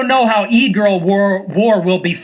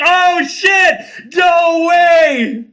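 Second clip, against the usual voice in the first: high, drawn-out crowd holler; thrilled shout; 8 semitones higher, much slower than usual. Acted. Spectator in the stands hearing a shocking reveal, thrilled shout. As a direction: shout it like a stunned fan ringside.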